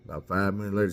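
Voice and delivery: ordinary delivery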